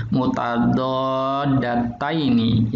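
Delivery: "Mutadoddatani" stretched out syllable by syllable; very slow teacher pronunciation; slow modelled Arabic word. Shown as a demonstration. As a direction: model "Mutadoddatani" slowly for the class. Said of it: "teacher models word".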